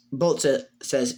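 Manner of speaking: usual speaking voice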